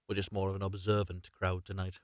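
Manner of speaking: ordinary delivery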